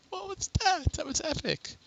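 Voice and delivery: very high squeaky disbelief; amazed. Real, not acted. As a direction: squeak it out, amazed after laughing. Amazed by glitch, just laughed.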